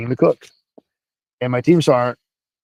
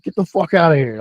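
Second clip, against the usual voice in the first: mild mock yell, slightly raised pitch; retold angry yell; 4 semitones higher than usual. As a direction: imitate a sergeant throwing someone out. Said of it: "team sergeant starts yelling at him".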